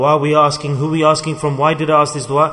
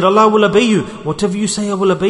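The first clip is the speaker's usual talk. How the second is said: eager pleading; 5 semitones higher, 4 dB louder, faster than usual. Acted. Man on branch pleading with God.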